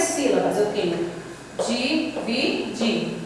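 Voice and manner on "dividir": DIVIDIR split slowly into di, vi, dir; three separate beats in dividir with pauses between; syllable by syllable. Shown as a demonstration. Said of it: "Três sílabas".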